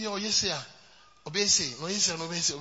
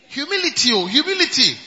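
loud exclaim; 3 semitones higher, 9 dB louder than usual. Shown as a demonstration. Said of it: "tell your neighbor".

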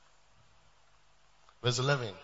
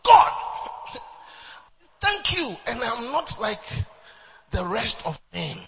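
high, showy opening settling down, mock-pious; self-righteous; 3 semitones higher, 6 dB louder than usual. Preacher acting out the Pharisee's prayer, self-righteous. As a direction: imitate a Pharisee praying proudly to himself. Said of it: "the way he mentioned God".

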